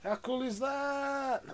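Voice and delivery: high, drawn-out marvelling; excited. Real, not acted. Builder marvels at the model, excited. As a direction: stretch it out high in delight.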